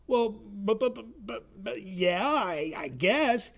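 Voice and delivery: a lightly acted stutter of confusion; flustered stammer. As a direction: trip over the buts, unsure. Chuck flustered.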